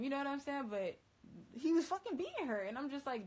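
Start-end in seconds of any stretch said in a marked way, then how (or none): 1.5-2.6 outraged. Real, not acted. Outraged retelling.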